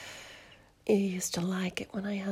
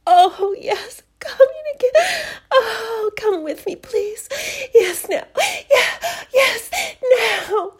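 high, loud, breathless cries of pleasure; climax cries; 10 semitones higher, 10 dB louder, slower than usual. Acted. Lover crying out at climax, high and loud. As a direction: let it build into shouted yeses.

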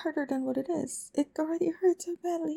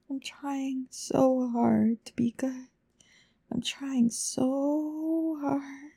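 slow strained plea; much slower than usual. Acted. Straining to be good.

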